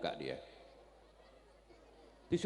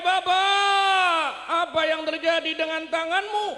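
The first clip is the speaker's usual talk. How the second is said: high, loud preaching cry; Prophet's question, shouted out; 8 semitones higher, 7 dB louder than usual. Acted.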